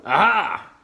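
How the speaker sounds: loud, high triumphant aha; triumphant cry. A narrator's reading. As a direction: crow it out in triumph. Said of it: "cried Dingo".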